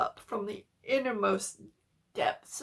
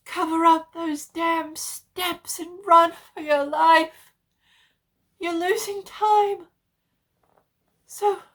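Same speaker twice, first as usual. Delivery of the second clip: resigned farewell; 7 semitones higher, 11 dB louder than usual. A narrator's reading. Dying friend says farewell.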